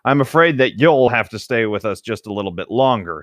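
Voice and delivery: ordinary delivery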